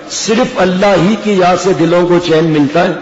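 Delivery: normal talking voice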